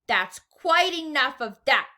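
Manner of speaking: loud, slow, firm shopkeeper; yelled. A narrator's reading. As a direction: shut the crowd down loudly. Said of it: "the fat shopkeeper yelled".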